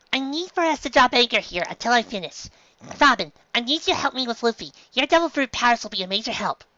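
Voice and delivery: put-on high young reindeer doctor, earnest; high, brisk little doctor voice; Chopper. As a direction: give orders as a small, serious doctor.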